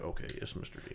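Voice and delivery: whispered surrender to the customer; meek whisper. Retold or imitated. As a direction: give in under your breath. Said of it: "And they're like".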